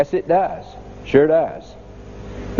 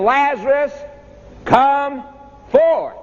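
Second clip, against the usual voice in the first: a ringing pulpit command; commanding call; 8 semitones higher, slower than usual. Acted. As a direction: summon the dead man out of the tomb. Preacher voicing Jesus' call.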